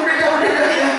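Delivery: high, loud repeated begging; acted panicked pleading. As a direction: act out begging for the ride to stop. Memohon wahana berhenti. (memohon panik dengan suara keras)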